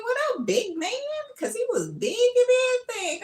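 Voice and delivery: very high, loud laughing voice; excited squeal. Retold or imitated. Greeting the big FedEx man, giddy high squeal.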